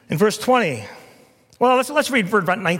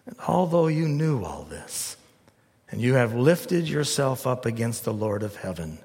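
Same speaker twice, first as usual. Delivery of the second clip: ordinary talk, nothing marked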